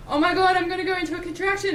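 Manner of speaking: fast, high, loud panicked rush; retold panic. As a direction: blurt it out in a sudden panic. Retelling panic during labor.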